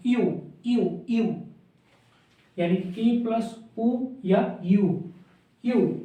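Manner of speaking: यू sounded out, ई blended into ऊ; demonstrating यू as ई plus ऊ. Shown as a demonstration. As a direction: glide ई into ऊ for यू. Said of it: "यू की sound".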